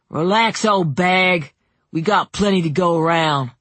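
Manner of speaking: lightly voiced insolent teen; a hint of swaggering taunt; mocking boy. A narrator's reading. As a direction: brush her off like a cocky kid. Boy mocks the clerk.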